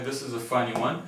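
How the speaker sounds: normal talking voice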